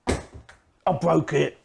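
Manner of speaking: normal talking voice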